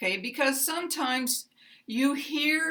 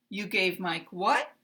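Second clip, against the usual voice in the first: you gave Mike what rising on what; uptalk; 3 semitones lower than usual. Shown as a demonstration. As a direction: pitch up on what at the end. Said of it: "I didn't get this word".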